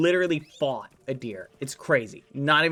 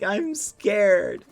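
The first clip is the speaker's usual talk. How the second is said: higher, louder little dog voice; voicing the dog; 7 semitones higher, 6 dB louder than usual. Owner voicing her frightened dog, mock timid. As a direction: speak for the scared pup. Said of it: "been like".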